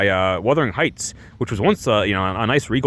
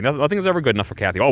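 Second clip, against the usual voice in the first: ordinary delivery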